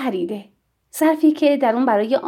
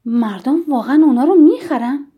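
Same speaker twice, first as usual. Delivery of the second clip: louder, swooping disbelief; astonished question; 3 semitones higher, 6 dB louder than usual. A narrator's reading. Visitor reacting to the chocolate's price tag, astonished. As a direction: ask it in open surprise, rising on the end. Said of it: "با تعجب گفت".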